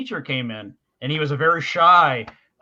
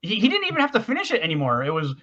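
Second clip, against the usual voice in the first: ordinary delivery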